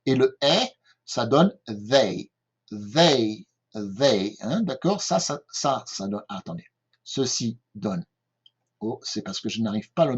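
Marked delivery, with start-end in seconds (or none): 1.7-4.4 "they" with the TH sound at the start. Shown as a demonstration. Teacher demonstrates the TH in "they". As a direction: model "they" slowly for the class. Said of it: "ce T H qui se prononce".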